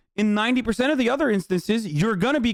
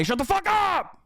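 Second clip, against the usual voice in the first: higher pitched yell cutting chat off; angry shout; 7 semitones higher than usual. Real, not acted. Streamer yelling at complaining chat, furious shout. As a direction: snap at them to shut up.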